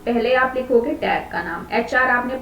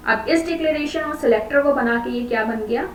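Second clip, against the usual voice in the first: normal talking voice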